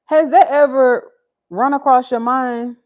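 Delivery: loud, high, pressing questions at the viewers; rising indignant appeal; emphatic rant to chat. Real, not acted. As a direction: demand an answer from the chat. Mother ranting to live chat.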